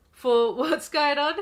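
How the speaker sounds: amused, cracking up; laughing. Real, not acted. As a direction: laugh through the words. Laughs mid-line.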